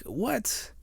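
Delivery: high, soft, incredulous squeak; upset crowd. Acted. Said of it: "the people are, like, upset".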